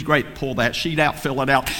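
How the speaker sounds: normal talking voice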